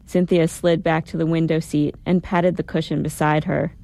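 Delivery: plain reading voice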